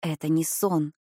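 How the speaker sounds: usual speaking voice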